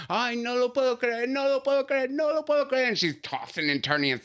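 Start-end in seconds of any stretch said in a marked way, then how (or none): 0.1-2.9 high, wailing Spanish lament; hammed-up high wail of disbelief; mock hysterical wail. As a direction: imitate mom's dramatic fit, pitched way up. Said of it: "she went into a fit and started saying".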